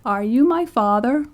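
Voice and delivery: a hint of a cracked old voice asking; old man asking his father, lightly voiced; cracked ancient voice. A narrator's reading. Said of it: "in a cracked and ancient voice".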